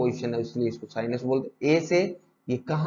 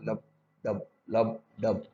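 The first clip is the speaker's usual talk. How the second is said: imitated heartbeat; 3 semitones lower, slower than usual. Shown as a demonstration. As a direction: tap out the heartbeat with the voice. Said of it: "वो कैसी होती है?".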